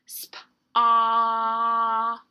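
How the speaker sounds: "Sp. Ah." with a loud, long held vowel; stretched-out word. Shown as a demonstration. Teacher helping children hear letters, stretched-out sounds. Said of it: "try to stretch out that word".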